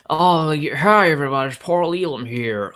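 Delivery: light put-on American accent, greeting viewers; a casual hammed-up American accent, no gravel; Paul Elam impression. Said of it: "I could do, like, an impression of him".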